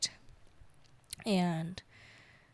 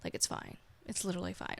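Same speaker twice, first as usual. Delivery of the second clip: softer, offhand brush-off; dismissive shrug; 2 semitones lower, 11 dB quieter, faster than usual. Retold or imitated. Brushing off the cat that bit her, soft and offhand. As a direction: shrug it off quietly like it doesn't matter. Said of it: "I was just like".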